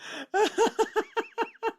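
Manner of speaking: high-pitched helpless laughing; real laughter. Real, not acted. Host still tickled by the nickname, high laughter. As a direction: keep laughing in a high run.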